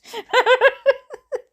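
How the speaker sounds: loud high giggling laugh; high burst of laughter. Real, not acted. Reader laughing at her own story. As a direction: break into laughter.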